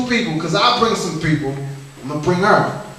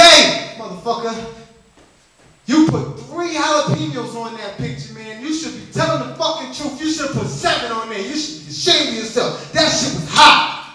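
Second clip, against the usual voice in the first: high-pitched acted scolding; telling someone off; 5 semitones higher than usual. Customer telling off the restaurant owner, indignant. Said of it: "gonna tell this motherfucker off".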